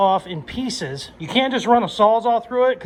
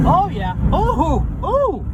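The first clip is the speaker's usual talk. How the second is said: high, loud thrilled oohs; 10 semitones higher, 4 dB louder, slower than usual. Real, not acted. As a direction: ooh with delight. Thrilled while driving.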